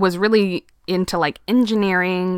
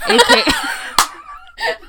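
laughing high shout, drawn out; much slower than usual. Real, not acted. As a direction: burst out laughing mid-word. Joking innuendo about lover.

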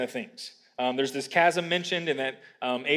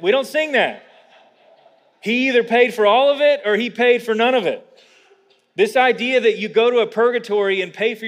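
raised, emphatic pulpit voice; forceful preaching; 5 semitones higher, 6 dB louder than usual. Real, not acted. Preacher warns congregation there are no do-overs, forceful preaching. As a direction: preach it with urgent conviction.

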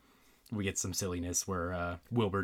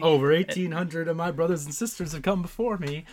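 big, louder, higher put-on voice; Ghost of Christmas Present; 8 semitones higher, 6 dB louder than usual. Host quoting the Muppet ghost, loud theatrical impression. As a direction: boom it out as a grand Muppet spirit. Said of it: "Christmas Present says that".

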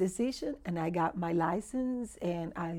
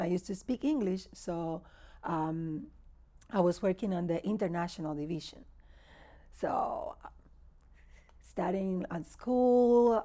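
ordinary talk

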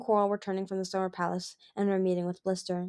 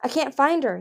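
nervous; 6 semitones higher, 5 dB louder than usual. Acted. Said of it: "he flashed nervously".

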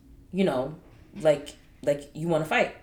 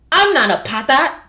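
loud, higher, defiant declaration; putting foot down; 7 semitones higher, 10 dB louder than usual. Retold or imitated. Telling Haitian parents off, loudly defiant. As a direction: stamp it out loudly, standing your ground. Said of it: "I put my foot down. Stamping my foot".

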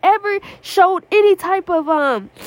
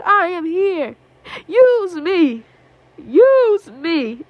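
crying plea; 2 semitones higher, much slower than usual. Retold or imitated. Retelling her tearful prayer.

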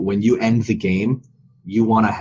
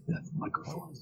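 breathy, spooky ghost whisper; 6 semitones higher, 16 dB quieter than usual. Pretend. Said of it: "That's my ghost voice".

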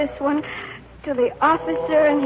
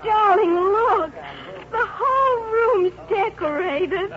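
slow, higher, close to tears; tearful wonder; 7 semitones higher, slower than usual. Acted. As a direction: marvel through tears. Bride moved by decorations.

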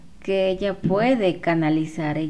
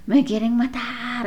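mild mock scream; 5 semitones higher, slower than usual. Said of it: "gritaba así".

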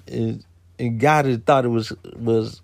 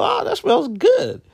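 high, slow voice for the niece; imitating niece. Niece sniffing the bottle, lightly voiced high delight. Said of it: "she go".

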